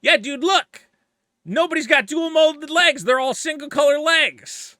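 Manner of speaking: high, loud excited fan voice; mock fanatic fan. Lego fan insisting on leg colors, loud and high. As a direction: ham up an insistent collector. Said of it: "And they're like".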